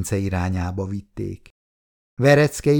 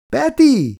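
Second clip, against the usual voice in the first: loud, very high cry of a name; desperate yell; 6 dB louder than usual. A narrator's reading. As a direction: scream out to a dying friend. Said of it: "ordította Vereckei".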